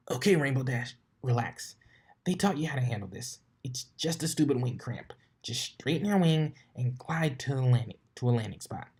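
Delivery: lightly voiced tomboyish pegasus, annoyed; a hint of a cocky flyer grumbling; irritated sporty pony voice; Rainbow Dash voice. Talking herself down.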